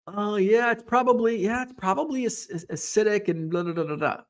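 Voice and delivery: breezy imitated brush-off, starting high; mock doctor not taking it seriously; dismissive doctor. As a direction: wave it away, tailing into blahs. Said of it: "just poo-pooed it off".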